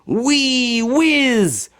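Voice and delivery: high, swooping whee; gleeful whoosh. A narrator's reading. Narrator skiing down the mountain, high and gleeful. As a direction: ride the sound down the slope.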